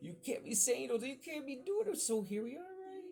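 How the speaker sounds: high, loud mock admonishing; mock scolding. Storyteller scolding about things you can't say, mock scolding. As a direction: pitch it way up like a playful telling-off.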